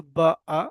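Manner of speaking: বা and আ given as separate, loud sounds; broken into sounds. Shown as a demonstration. Teacher breaking the word down for pupils, loud separate বা, আ. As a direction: sound out বা, then আ, loudly. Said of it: "আমাকে ভেঙে বলতে পারবেন".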